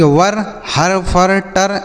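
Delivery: वर, हर, फर, टर without any 'ai' glide; wrong readings. Shown as a demonstration. Teacher testing students with misreadings, वर, हर, फर, टर flattened to one syllable. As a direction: say वर, हर, फर, टर as single syllables with plain 'a'. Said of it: "ऐसे पढ़ेंगे? नहीं".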